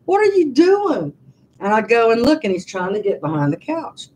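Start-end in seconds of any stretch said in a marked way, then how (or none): 0.0-1.1 puzzled high voice to dog. Retold or imitated. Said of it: "I'm like".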